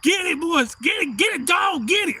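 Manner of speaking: high, loud egging on; whooped-up cheering on; excited yelling. Retold or imitated. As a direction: holler encouragement like a rowdy spectator. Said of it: "I'm yelling out my mama's side".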